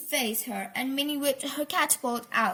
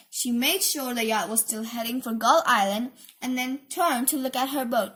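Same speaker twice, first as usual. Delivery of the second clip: ordinary delivery, nothing marked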